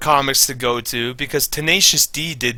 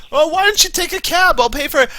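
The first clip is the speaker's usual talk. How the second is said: mild mock reply. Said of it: "She's like".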